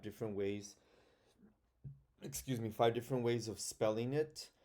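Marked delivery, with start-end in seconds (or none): none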